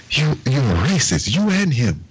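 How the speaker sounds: high, mock accusing voice; lightly voiced angry old man. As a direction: imitate him pointing the finger. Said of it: "he's like".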